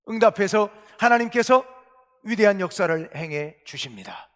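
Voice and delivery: ordinary talk, nothing marked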